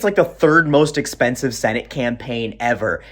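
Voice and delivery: normal talking voice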